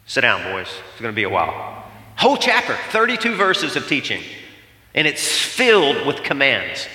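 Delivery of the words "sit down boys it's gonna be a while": lower, calm voice of Jesus. Retold or imitated. Jesus telling his disciples to settle in, low and calm. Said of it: "And he goes".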